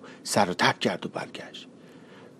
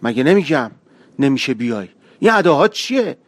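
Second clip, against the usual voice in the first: angry; 5 semitones higher, 8 dB louder than usual. Acted. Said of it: "پسر با غیظ اومد".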